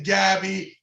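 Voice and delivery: usual speaking voice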